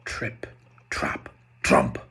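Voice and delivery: very low, heavy trump; heavy hoofsteps. Acted. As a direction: thunder the steps down low. Big goat hoofsteps.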